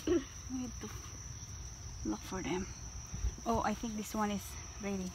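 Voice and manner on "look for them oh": ordinary delivery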